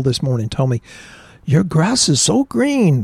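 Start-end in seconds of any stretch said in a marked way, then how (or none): 1.4-3.0 higher, drawn-out mock Spanish accent. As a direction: put on a rough Spanish accent, pitched up and slow. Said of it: "That's my Spanish accent".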